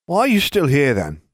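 put-on a boy demanding, loud and high-pitched; demanding shout. A narrator's reading. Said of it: "Dudley demanded".

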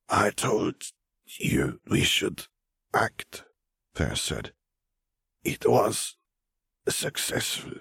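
lightly voiced old wizard, hushed and slow; hushed old wizard fading to a whisper; voice of Fer. Fer's voice, hushed.

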